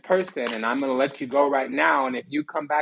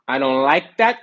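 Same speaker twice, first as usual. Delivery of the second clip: loud displeasure; 8 dB louder than usual. Real, not acted. Reacting to a card.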